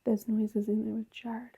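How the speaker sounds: hushed whisper, barely voiced. Real, not acted. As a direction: whisper it so nothing hears. Hushed during ghost hunt.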